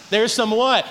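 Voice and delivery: usual speaking voice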